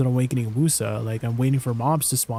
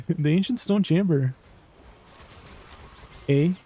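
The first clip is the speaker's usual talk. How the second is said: put-on playful Canadian accent on "Stone"; 3 semitones higher than usual. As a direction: lean into Canadian, ending on "Eh?". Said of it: "Not my Canadian roots coming out".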